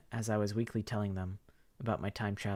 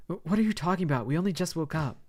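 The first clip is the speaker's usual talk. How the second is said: put-on high, stammering girl's voice; baffled, can't follow her; confused friend; 7 semitones higher, 6 dB louder than usual.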